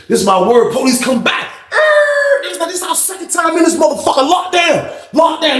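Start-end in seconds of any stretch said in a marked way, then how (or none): 1.7-5.6 much higher, incredulous; exasperated outburst. Real, not acted. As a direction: cry out in disbelief at the lockdown. Exasperated about second lockdown.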